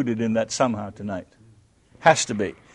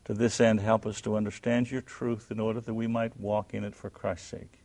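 soft, low, unhurried prayer; closing prayer; 6 semitones lower, 4 dB quieter than usual. Acted. Pastor leading his class in a soft closing prayer. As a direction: pray quietly, earnestly, winding down.

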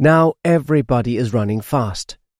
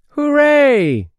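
high, loud cheer; shout; 14 semitones higher, 5 dB louder than usual. A narrator's reading. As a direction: cheer it out together. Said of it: "they shout".

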